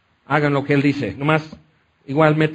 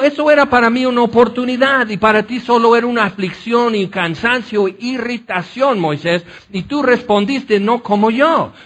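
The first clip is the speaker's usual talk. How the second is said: much higher, put-on voice of God, gently disappointed; God reproaching; 5 semitones higher than usual. (Dios le reprocha a Moisés, voz aguda y dolida)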